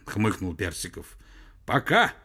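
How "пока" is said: higher, louder short goodbye; curt phone sign-off. A narrator's reading. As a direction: sign off abruptly. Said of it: "цокнула трубка и стихла".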